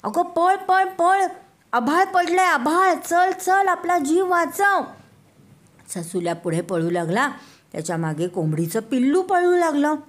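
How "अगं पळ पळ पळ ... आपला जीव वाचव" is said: high, loud panicked urging; frantic, begging her to run; frightened rabbit. Acted. Rabbit urging the chick to flee, high frantic shout. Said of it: "ससुल्या म्हणाला".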